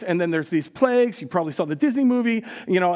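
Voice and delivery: ordinary talk, nothing marked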